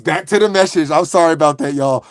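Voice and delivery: normal talking voice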